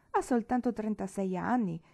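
ordinary delivery, nothing marked

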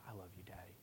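very soft, low, almost whispered affection; tender child. Retold or imitated. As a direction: imitate a small child's hushed confession of love. Calmed daughter speaks to her father.

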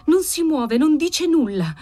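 high, entranced voice; talking from inside a dream; dreamy murmur. A narrator's reading. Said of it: "mormorò ella parlando in sogno".

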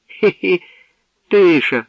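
high, loud sneering giggle that mimics the word тиша; mocking giggle. A narrator's reading. Jester Mechyk sneers at the hero's answer, mocking laugh. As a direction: throw тиша back at him with a jeering high laugh. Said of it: "ошкірив зуби Мечик".

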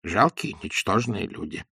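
a sulky, muttered insult; angry mutter. A narrator's reading. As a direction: mutter the insult under your breath, offended. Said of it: "сердито забормотал Паниковский".

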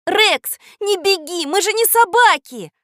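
high, loud pleading shout. Acted. Katya calls after the running dog, shouting. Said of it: "кричала Катя".

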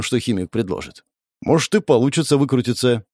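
usual speaking voice

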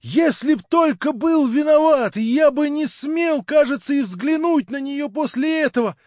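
high, loud excited shouting; crying out to the room in rapture. A narrator's reading. Young man shouting to the narrator, overjoyed. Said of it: "кричал он".

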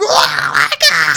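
slow, low laughing "Oh my God"; crowd laughing. Retold or imitated. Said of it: "the crowd's like".

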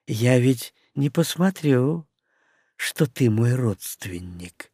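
soft, slow sultry menace; femme fatale. Acted. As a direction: threaten sweetly and slowly. Witch threatens Henry softly.

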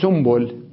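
سنبل pronounced "sumbul"; nun turned to mim. Shown as a demonstration. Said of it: "أقلبها ميما".

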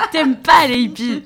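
corrected line with real laughter; corrected, laughing. Real, not acted. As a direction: laugh while saying the right version.

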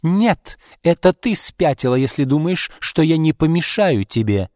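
high, loud angry comeback; acted heated outburst; furious retort. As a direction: throw the accusation back at her.

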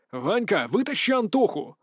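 growled in anger at himself; angry growl. A narrator's reading. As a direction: snarl the order, angry. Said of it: "рычу я от злости". (злобный рык)

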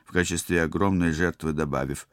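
usual speaking voice

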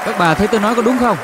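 loud, fast, high teasing question; teasing shout to the crowd. Real, not acted. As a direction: fire it at the ladies, fast and loud. Trêu khán giả, hỏi to. (hỏi to trêu các bà)

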